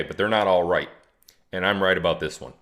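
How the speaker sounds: normal talking voice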